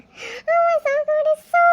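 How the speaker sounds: high, loud fangirl gush; real gushing delight; excited squeal. Real, not acted. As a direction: squeal with adoration, pitch soaring. Fan gushing over album pictures.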